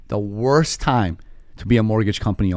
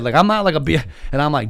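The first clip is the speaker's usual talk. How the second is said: ordinary delivery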